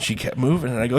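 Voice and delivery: usual speaking voice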